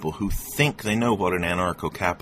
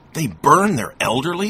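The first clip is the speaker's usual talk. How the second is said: much higher and louder, alarmed; 10 semitones higher, 9 dB louder than usual. A narrator's reading. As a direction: gasp it out in alarm. Traveler alarmed thought.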